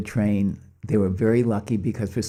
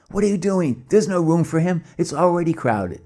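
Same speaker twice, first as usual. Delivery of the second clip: high, loud protesting crowd; outraged passengers yelling high; angry uproar; 8 semitones higher, 5 dB louder than usual. Retold or imitated. Passengers in uproar over boy.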